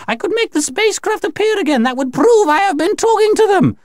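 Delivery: very high, sustained frantic plea; high alien pleading; desperate bargaining. Acted. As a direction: bargain in panic, pitched right up. Alien aunt bargains for release.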